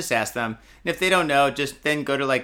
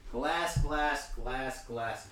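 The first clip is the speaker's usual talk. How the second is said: muttering to himself; 8 dB quieter, much slower than usual. Real, not acted. Searching for a glass.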